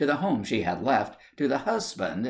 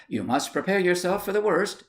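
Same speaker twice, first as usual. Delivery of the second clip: aunt's solemn warning, lightly higher; grave warning; 9 semitones higher than usual. Acted. Aunt warns her niece, a touch higher and grave. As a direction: brace her for the worst. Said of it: "said the aunt".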